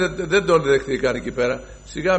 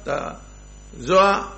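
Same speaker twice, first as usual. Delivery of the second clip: ordinary delivery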